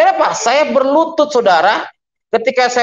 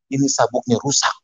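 sheepish reply; 8 semitones lower, 5 dB quieter than usual. Retold or imitated. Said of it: "saya bilang".

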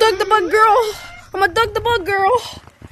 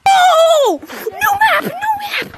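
very high, urgent squeals; high bursts of overexcited yelling; excited shrieking; 11 semitones higher, 4 dB louder, much slower than usual. Real, not acted. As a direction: shriek it out in disbelief. Discovers a new map.

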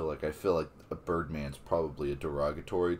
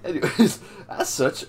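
laughing through the words; 11 semitones higher, 10 dB louder than usual. Acted. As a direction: still giggling while rereading. Rereads line after laughing fit.

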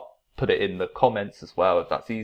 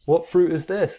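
put-on teacher voice for kids; 9 semitones higher, 4 dB louder than usual. Shown as a demonstration. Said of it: "And I'm like".

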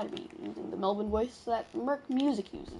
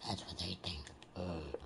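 very low, rumbling troll voice; deep villain voice; 14 dB quieter than usual. Acted. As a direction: menace them in a deep growl. Trolling the enemy.

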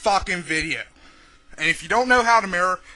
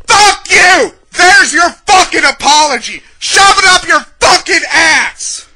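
very loud, raised-pitch yelling; furious shout; 6 semitones higher, 8 dB louder than usual. Real, not acted. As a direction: blast the insult at them. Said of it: "this is gonna be a fucking angry".

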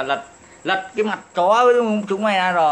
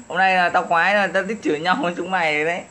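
ordinary talk

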